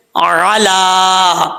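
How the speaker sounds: اعلیٰ with the laam stretched one alif long; lengthened vowel. Shown as a demonstration. Teacher stretching اعلیٰ for students to hear the length. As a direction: draw out اعلیٰ slowly, holding the long aa. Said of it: "لام کے اوپر کھڑا زبر".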